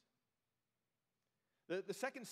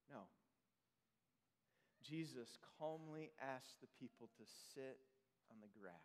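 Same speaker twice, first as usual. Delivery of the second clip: calm hush; 7 semitones lower, 8 dB quieter than usual. Real, not acted. Preacher calming his listeners, soft and low. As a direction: settle the room into stillness.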